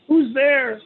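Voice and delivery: high, loud frightened call through the door; a startled, fearful who's there; frightened widow calling out. A narrator's reading. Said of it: "asks a frightened voice".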